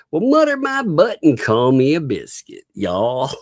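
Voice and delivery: playful exaggerated Southern reading; Southern accent. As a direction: read the chat line in a thick Southern accent. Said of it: "You put on a Southern accent".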